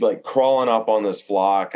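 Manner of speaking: ordinary delivery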